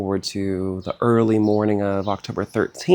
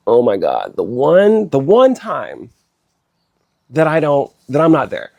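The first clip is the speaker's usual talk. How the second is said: louder, reliving the shock of the news; alarmed outburst; 3 semitones higher, 7 dB louder than usual. Retold or imitated. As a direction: cry it out, stunned and upset. Shock at hearing mom fell.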